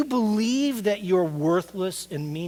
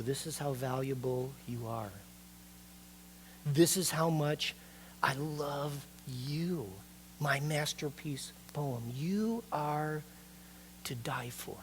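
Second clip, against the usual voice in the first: tender voice of Jesus; 3 semitones lower, 6 dB quieter than usual. Acted. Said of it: "he's saying".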